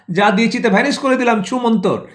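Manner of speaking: snatch back the gift with a magician's flourish; sly showman vanishing the handouts; mocking magician government. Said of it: "পরের খেলা".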